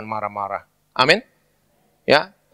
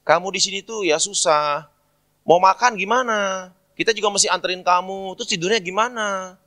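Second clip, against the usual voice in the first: put-on older sibling pleading; 7 semitones higher than usual. Older sibling to stranded younger one, pleading complaint. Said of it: "Kalau saya umpama kakaknya ya".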